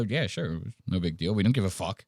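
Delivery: low, soft, offhand shrug of a voice; mock indifference. Cops answering the vlogger, imitated as indifferent.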